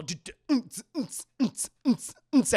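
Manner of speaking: mouthed dance beat in short bursts; beatbox a thumping club track; imitated club beat. Narrator mimics the club as lights come on, imitated beat. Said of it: "the lights come on. It's all".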